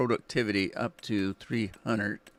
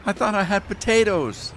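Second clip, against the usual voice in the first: indignant surprise; 9 semitones higher, 4 dB louder than usual. Real, not acted. Realises wrong crop loaded.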